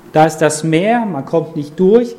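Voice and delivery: ordinary talk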